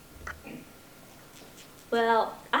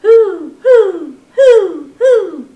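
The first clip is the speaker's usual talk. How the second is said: very loud, higher owl hoots; hooting owl; 6 semitones higher, 18 dB louder, much slower than usual. Acted. Storyteller voicing Owl for the children, loud hoots. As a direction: call out like an owl into the night. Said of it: "called Owl".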